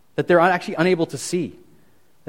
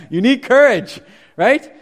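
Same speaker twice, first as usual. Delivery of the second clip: louder and higher, laughing through it; 4 semitones higher, 6 dB louder than usual. Real, not acted. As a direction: laugh while stressing courage. Laughing at his own point.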